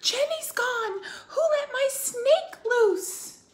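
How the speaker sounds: high, loud, slow panicked yell; loud alarmed shout. Acted. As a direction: scream it like a scared girl. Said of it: "Emily shouts".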